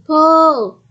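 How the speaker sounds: very loud, high, long-held shout; shouted command. A narrator's reading. Farmer rallies wife and Jack to tug, shouted. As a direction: shout the heave on the count. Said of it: "the farmer shouted".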